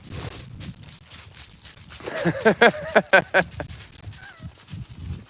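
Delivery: long, high burst of laughter; real laughter. Real, not acted. Laughing at the talk of swimming with dolphins, real laughter. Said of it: "I'm laughing because".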